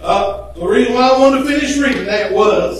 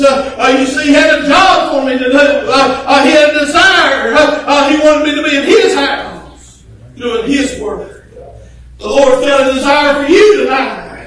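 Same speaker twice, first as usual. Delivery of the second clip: louder, rhythmic preaching with uh fillers; fiery preaching; 4 semitones higher, 6 dB louder than usual. Acted. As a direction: preach it to the congregation, half-singing. Preaching at full force.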